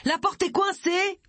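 high, slow cry for help; drawn-out high-pitched shout. A narrator's reading. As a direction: call out through the stuck door. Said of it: "cria-t-elle".

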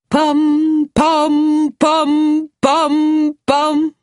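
high, ringing bell sounds; bell tolling. A narrator's reading. Narrator mimics the village church bells, ringing. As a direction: chime each pom like a church bell.

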